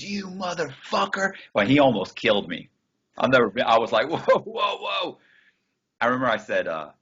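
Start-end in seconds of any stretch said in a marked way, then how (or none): 0.0-1.3 mock outrage. Eric reacts to stolen ID. 4.1-5.2 alarmed shout. Retold or imitated. Calming a furious Eric with a high shouted whoa.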